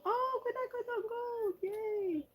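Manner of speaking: high, thrilled squeal; very high, happy yay; excited squeal. Real, not acted. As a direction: squeal as if it's the one you wanted. Squeals at favorite paint.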